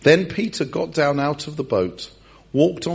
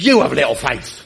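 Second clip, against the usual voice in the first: loud, strained, hammed-up telling-off; mock angry rebuke; 7 dB louder than usual. Jesus kneeling on Pete's chest, mock angry rebuke. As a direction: bawl it as if pinning someone down. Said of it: "Jesus has got his knee on Pete's chest".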